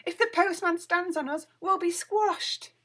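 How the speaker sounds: high, loud frightened cry; panicked. A narrator's reading. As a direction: plead in alarm. Winnie panicking, fear of squashing.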